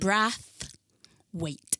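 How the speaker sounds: Brathwaite broken into "Brath... waite"; short A in Brathwaite, syllables spaced out; careful name pronunciation. Shown as a demonstration. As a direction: say Brathwaite slowly as "brath-wait". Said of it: "My name is".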